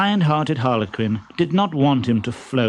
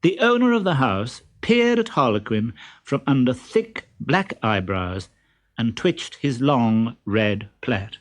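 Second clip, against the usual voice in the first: ordinary delivery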